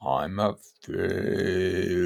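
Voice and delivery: drawn out, each word stretched; very slow. Shown as a demonstration. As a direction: say it really slowly. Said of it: "let's do it really slowly".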